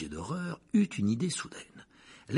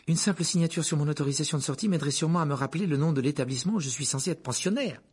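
put-on fast, higher bargaining boy; hurried; 6 semitones higher, 3 dB louder, faster than usual. A narrator's reading. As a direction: rush the offer out. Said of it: "reprit précipitamment Harry".

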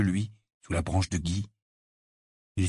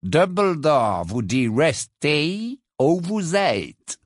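very slow, higher, condescending; haughty portrait; 5 semitones higher, much slower than usual. Acted. Portrait repeating Dumbledore's order to Harry, patronising.

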